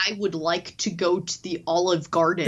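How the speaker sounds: put-on American as Brits do it; lightly hammed-up American accent; mock American accent. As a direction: say it like a Brit faking American. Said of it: "They're like".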